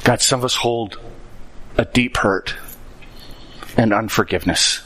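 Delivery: low, soft prayer voice. Real, not acted. Pastor praying for the congregation to forgive, soft and low. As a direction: pray quietly for help to forgive.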